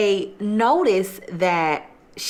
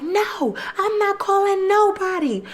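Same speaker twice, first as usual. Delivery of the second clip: very high-pitched, defiant imitation; high mock-hysterical retort; mock angry refusal; 13 semitones higher than usual. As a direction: mimic her refusing in a squealed voice. Acting out her angry refusal.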